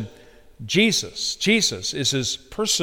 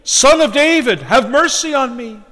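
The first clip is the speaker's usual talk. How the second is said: loud, much higher plea; crying out more; 8 semitones higher, 5 dB louder than usual. Retold or imitated. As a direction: cry out insistently over the crowd. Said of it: "he cried the more, a great deal".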